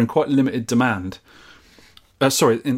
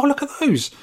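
high-pitched delighted quote of oneself; excited discovery; 13 semitones higher than usual. Retold or imitated. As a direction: recall your delight at a find, pitch leaping up. Recalls spotting old iMacs in window.

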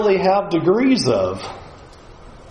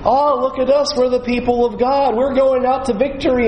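high, self-satisfied mock brag; smug boasting; 7 semitones higher than usual. Preacher mocking the people's proud boast, high and smug. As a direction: puff up like a proud crowd.

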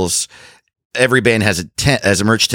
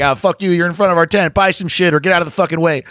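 higher, mock heckling of the kids; teasing rant at the line of kids; retold yelling; 8 semitones higher, faster than usual. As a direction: yell it at fans blocking the tent, half-joking.

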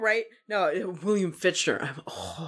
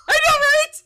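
gasp, then much higher, louder cry; excited gasp; 6 dB louder, slower than usual. Real, not acted. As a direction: squeal with delight. Thrilled reading description.

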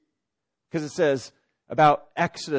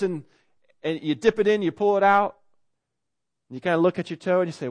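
normal talking voice

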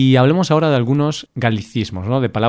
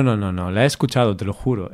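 normal talking voice

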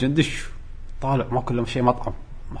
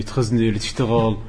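ordinary talk

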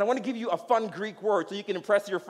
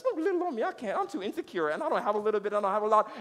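whiny insecure excuse; 3 semitones higher, faster than usual. Acted. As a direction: play someone pleading they can't. Voicing someone's insecure excuse.

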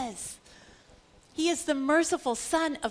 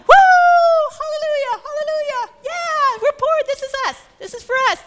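ecstatic crowd; 13 semitones higher, 11 dB louder than usual. Acted. Crowd cheering the first beatitude.

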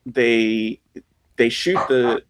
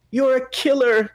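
high-pitched imitation of her accusation; put-on high accusing line; mock accusation; 12 semitones higher than usual. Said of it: "she's like".

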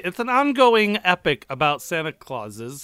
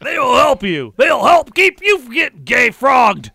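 mock ranting shout; 5 semitones higher, 5 dB louder than usual. Mock Alex Jones ad shouting.

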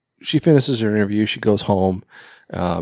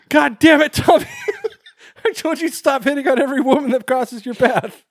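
exasperated receptionist yell. Retold or imitated. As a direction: yell at him, fed up, then break into a laugh. Receptionist yells at Tommy again.

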